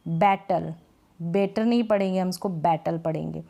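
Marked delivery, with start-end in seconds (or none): none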